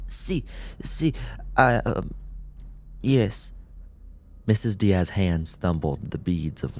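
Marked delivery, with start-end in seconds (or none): 0.1-3.5 higher, breathless hesitant; Spanish old woman hesitating; frail old woman. A narrator's reading. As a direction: answer shakily.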